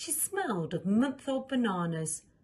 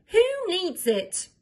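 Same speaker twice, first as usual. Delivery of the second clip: defiant scoff; 11 semitones higher, 7 dB louder, slower than usual. Acted. Defiant princess.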